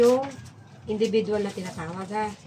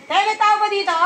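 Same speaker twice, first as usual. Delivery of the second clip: calling from far away; 10 semitones higher, 8 dB louder than usual. Real, not acted. Calling at a house door.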